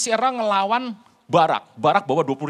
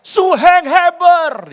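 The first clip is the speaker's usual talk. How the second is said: high, loud, slow call at the tent; shouting a name; 12 semitones higher, 14 dB louder, much slower than usual. Retold or imitated. Sisera shouting for Heber at his tent, high and slow. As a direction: call out for your elder brother. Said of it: "Dia teriak".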